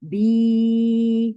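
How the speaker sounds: very slow, with बी drawn out for two seconds; long held vowel. Shown as a demonstration. Teacher shows how long to hold बी. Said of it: "दो second तक आपकी आवाज खींचे".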